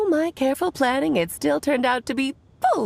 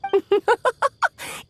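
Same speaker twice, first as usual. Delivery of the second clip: bright amused giggle; light laugh; 11 semitones higher than usual. Acted. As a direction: laugh lightly, pleased to see her. Amused at the late arrival.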